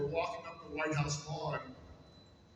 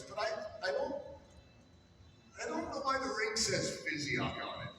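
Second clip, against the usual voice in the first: higher, stammering confusion; acted bewilderment; 7 semitones higher, 3 dB quieter than usual. Press secretary puzzles over the engraved ring, acted bewilderment. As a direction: imitate someone puzzled, pitched up and stumbling. Retold official's puzzled discovery on camera.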